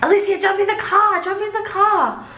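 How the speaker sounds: high, quick pleading; high fast coaxing; urgent family plea. Retold or imitated. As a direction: hurry her back into the car. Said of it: "they're like".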